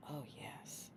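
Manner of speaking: whispered yes of pleasure; hushed satisfied breath; blissful whisper. Real, not acted. Approving her new scent blend in a pleased whisper.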